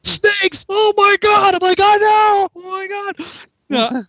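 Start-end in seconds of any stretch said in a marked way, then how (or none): 0.0-1.5 high mock shriek building louder; horrified sergeant. Sergeant hears about snakes, mock horrified shout. 1.8-3.3 high mock wail, loud then dropping off; panicked agreement. Recruit agrees with sergeant, mock-panicked wail.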